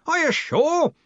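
very high, sharp query; demanding. A narrator's reading. Arch-Chancellor doubting the young wizard, demanding. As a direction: demand it, pitch jumping up. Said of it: "the Arch-Chancellor demanded".

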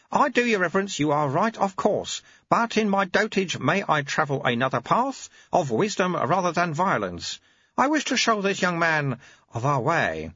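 put-on wry, patient old teacher; old monk Lutze. Lutze answers.

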